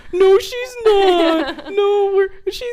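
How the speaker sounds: high, drawn-out whiny protest; hammed-up wailing denial; flustered denial. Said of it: "and he's like".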